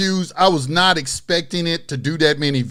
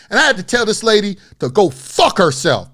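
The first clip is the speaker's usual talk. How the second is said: ordinary delivery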